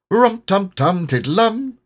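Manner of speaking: slow sing-song Pooh voice. A narrator's reading. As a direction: hum it the way Pooh would. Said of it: "in a voice like Pooh's".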